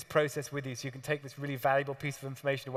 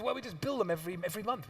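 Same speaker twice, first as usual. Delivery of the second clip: lightly posh Q; 4 semitones higher, 4 dB quieter, faster than usual. Retold or imitated. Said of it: "most outrageous accent".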